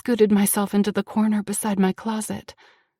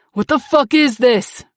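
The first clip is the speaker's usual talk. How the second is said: angry; 3 semitones higher, 8 dB louder than usual. Acted. Abuser demanding.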